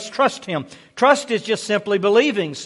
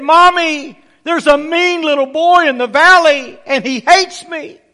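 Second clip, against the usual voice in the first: put-on high, loud child's complaint; upset little boy; 8 semitones higher, 7 dB louder than usual. Scared boy runs to tell his mother, high upset child voice. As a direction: wail it like a small boy telling on someone.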